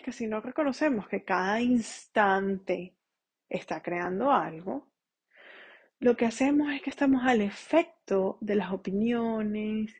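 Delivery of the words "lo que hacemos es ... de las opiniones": breathy whisper. Real, not acted. Sudden unvoiced line.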